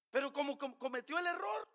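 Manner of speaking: a sudden preaching outburst; preaching shout. Real, not acted. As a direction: hammer the point home at full force. Preacher stressing a point.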